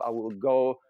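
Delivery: ordinary talk, nothing marked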